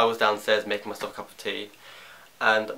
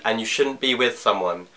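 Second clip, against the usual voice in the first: ordinary talk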